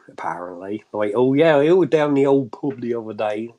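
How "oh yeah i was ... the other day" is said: broad mock Fens country accent; loud, hammed-up Fenland local. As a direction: put on a rustic Cambridgeshire Fen voice. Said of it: "they all talk like this".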